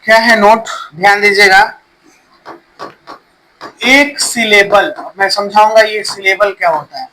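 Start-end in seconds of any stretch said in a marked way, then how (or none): 3.9-4.9 slow dictation. Shown as a demonstration. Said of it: "note लिख लीजिएगा आप लोग".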